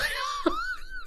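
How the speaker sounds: high, bursting laugh; real laugh. Real, not acted. Guest laughing at his reading coming true, real high laugh. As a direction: let a delighted laugh burst out.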